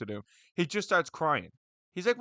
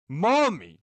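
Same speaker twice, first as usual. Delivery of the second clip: "Maul" hit louder with a falling tune; stress on maul; 3 semitones higher, 6 dB louder than usual. Real, not acted. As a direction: vlogger repeats his own words to drive them home, "Maul" stressed louder.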